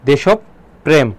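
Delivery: দেশপ্রেম split as 'deshop... prem'; দ্বিত্ব প. Shown as a demonstration. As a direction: halt on the first p of দেশপ্রেম. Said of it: "এই জন্য প কয়বার হবে". (দেশপ্রেম ভেঙে 'দেশোপ্-প্রেম')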